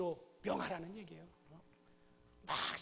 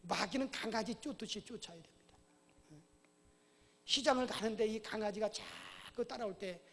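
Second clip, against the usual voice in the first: ordinary talk, nothing marked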